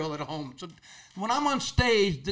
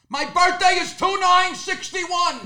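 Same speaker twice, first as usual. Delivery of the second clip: high, slow shout to be understood; exasperated shouting; 11 semitones higher, 3 dB louder, slower than usual. Acted. Customer giving his birthday to the pharmacist, slow shout. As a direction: shout each number out, stretched and high.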